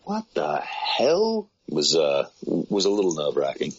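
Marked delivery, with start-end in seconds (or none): 0.1-1.5 high, drawn-out disbelief; acted incredulous reaction, very slow and high; incredulous colleague. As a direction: stretch it out slowly, pitched way up, as an appalled listener. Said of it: "somebody in the office just going".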